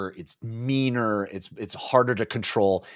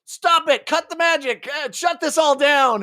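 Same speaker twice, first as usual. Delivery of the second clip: mock panic shout; 5 dB louder than usual. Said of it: "the Tistidu are like".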